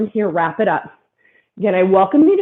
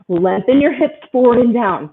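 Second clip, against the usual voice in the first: ordinary talk, nothing marked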